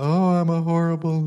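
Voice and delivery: hammed-up self-pitying wail; mock self-pity. Preacher acting out going around and around, mock self-pity. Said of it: "you get into your self-pity thing".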